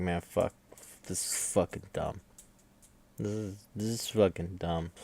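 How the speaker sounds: low, soft, sulky grumbling; sulky grumble. Retold or imitated. Bandmates sulking while Medici gets praised, quiet grumble. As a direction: mutter it like a jealous bandmate who has to sit there.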